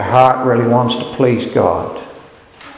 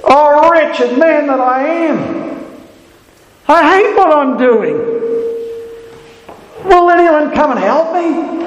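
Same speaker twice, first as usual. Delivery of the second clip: anguished cry of Paul; 13 semitones higher than usual. Acted. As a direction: wail it as a man at the end of himself. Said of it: "there's this cry of Paul".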